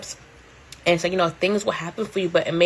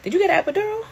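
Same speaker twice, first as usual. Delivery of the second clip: high question; 13 semitones higher, much faster than usual. Real, not acted. Reading viewer comment question.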